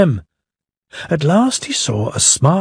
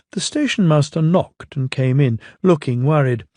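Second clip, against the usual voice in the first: ordinary delivery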